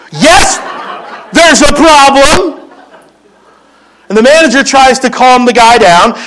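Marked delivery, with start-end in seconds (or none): 0.1-2.5 pitched-up, slow, huffy "there's a problem"; a hint of the furious diner, half laughing; indignant customer. Angry customer answers manager.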